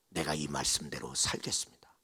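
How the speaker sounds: low, hushed resolve; quiet vow. Acted. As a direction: murmur a solemn promise, low and soft. 회개 뒤 결단을 읊조림. (낮고 조용한 다짐)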